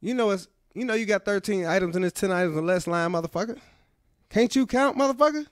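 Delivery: high mock Jackson scolding; Samuel L. Jackson. Comic voicing the actor at the express checkout line, high scolding. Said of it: "he'll say stuff like".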